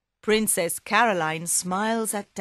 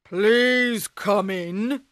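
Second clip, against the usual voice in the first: ogre; 3 semitones higher, 5 dB louder, much slower than usual. Acted. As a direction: draw it out like a heavy ogre. Ogre invites Puss in.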